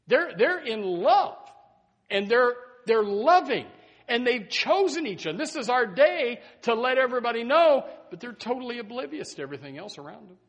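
high, loud, building sermon climax; fiery preaching. Acted. Preacher to congregation about the couple, full-force preaching. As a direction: drive the point home to the congregation.

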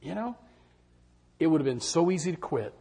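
usual speaking voice

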